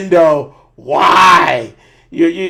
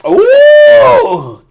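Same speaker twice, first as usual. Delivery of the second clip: excited whoop; 9 dB louder than usual. Real, not acted. Excited yelp joining final round.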